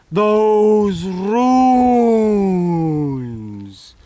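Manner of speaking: "Those" boomed loud, then a long pause before "runes."; booming dramatic repeat. Acted.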